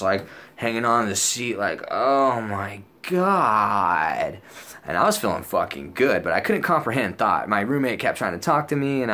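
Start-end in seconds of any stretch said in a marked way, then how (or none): 1.9-4.4 very slow, drawn-out gasp of awe; dazed, dragged-out exclamation; overwhelmed daze. Retold or imitated. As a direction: stretch every word as if reeling. Reenacts being overwhelmed by ketamine.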